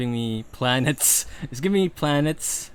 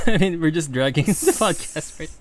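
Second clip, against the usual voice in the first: laughing; 4 semitones higher, 8 dB louder than usual. Real, not acted. Laughing at dragging podcast.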